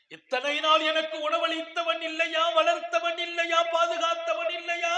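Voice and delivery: high, loud, shouted rhetorical questions; loud fiery sermon climax; preaching at full force. Acted. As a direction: cry out to the crowd, building the plea. Woman's plea about her Lord.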